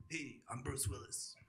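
a hint of a weary action star; Bruce Willis. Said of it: "name a famous person".

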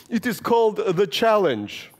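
put-on high, slow, proud little boy; a child with a Russian accent, high and deliberate; proud child announcing. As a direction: announce the title like a solemn kid. Child announcing his novel.

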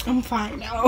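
a quiet "I'm fine now", trailing down; soft reply. Retold or imitated. Repeated reply while embarrassed.

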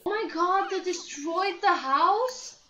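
loud, slow, high disbelief at the wrecked house; shocked. Real, not acted. Player seeing the destroyed house, loud shocked disbelief. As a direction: gasp it out in horror.

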